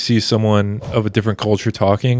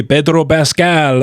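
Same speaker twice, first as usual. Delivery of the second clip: put-on name in a sudden Spanish accent; higher, slow, rolled-out Spanish name; Pedro Pascal; 5 semitones higher, slower than usual. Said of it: "he comes into the accent for".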